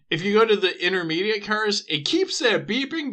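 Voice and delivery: usual speaking voice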